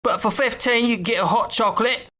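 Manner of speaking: high, singsong Cockney conductor; chirpy conductor listing prices; same Jim Dale tone. Retold or imitated. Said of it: "in that same tone".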